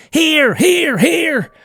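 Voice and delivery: high, loud call to the dogs; old man at the door calling his dogs, loud and high. A narrator's reading. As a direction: holler high for the hounds. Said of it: "He went to the door and called".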